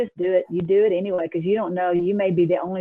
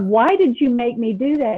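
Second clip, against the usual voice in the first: mild mock yell, higher pitched; retold yelling at God; 5 semitones higher, 4 dB louder than usual. Driver complaining to God after obeying, mock-angry yell. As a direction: protest to God, half-yelled in retelling. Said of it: "but I'm like".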